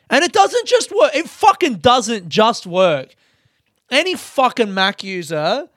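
high, loud outburst, slowing on the repeat; angry rant. Real, not acted. Ranting at his Mac, a furious yelled complaint. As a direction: fume at the laptop that failed.

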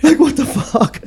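high, drawn-out laughing disbelief; shocked laughing disbelief. Retold or imitated. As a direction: squeal it out through a laugh. Beetlejuice suddenly in front of him.

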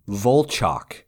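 "Volchok" with an open "chock" ending; pronounced Volchok. Retold or imitated. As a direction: say "Volchok" to rhyme its end with "chalk". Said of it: "Is that the correct pronunciation?".

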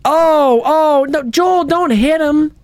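high pitched alarmed cries; alarmed shouting. Pretend. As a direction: cry out in mock alarm. Pretend alarm at dog beating.

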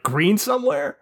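breezy, oblivious, like the red light doesn't exist; high mocking impression of a clueless driver; careless driver. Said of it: "this guy's like".

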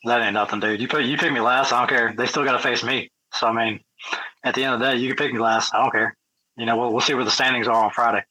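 ordinary delivery

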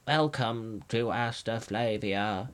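slow, soft royal welcome; dummy king's voice. A narrator's reading. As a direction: speak slowly and softly as a hidden king. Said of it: "there came a voice".

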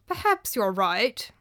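slow, hesitant concession; slowly. Acted. Master gives in to his servant, slowly. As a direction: weigh each word before agreeing. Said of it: "said Theopropides slowly".

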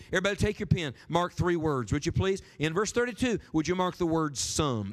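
normal talking voice

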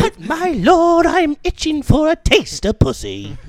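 high, loud mock-medieval begging; pleading peasant. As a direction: beg your lord like a peasant. Keeps peasant plea to lord.

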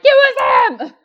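high, loud tattling wail; mock accusing cry. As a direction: imitate someone ratting out a friend. Imagined friend telling on the culprit.

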